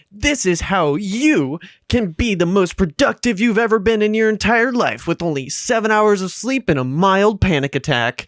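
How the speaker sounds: high, overblown YouTuber pitch; hammed-up YouTube hype, mocking it. Guest mocking preachy productivity videos, put-on YouTube voice. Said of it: "The YouTube voice".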